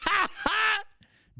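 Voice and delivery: high squealing laugh breaking out; burst of laughter. Real, not acted. Host laughing at the clip he plays. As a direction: crack up at the clip.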